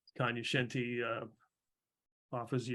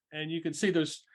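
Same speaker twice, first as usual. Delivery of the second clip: ordinary talk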